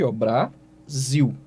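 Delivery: Brasil said slowly as Bra-sil; slow, syllable by syllable Brasil; syllable split. Shown as a demonstration. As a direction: break Brasil apart slowly for the class. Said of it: "separação desse jeito aqui".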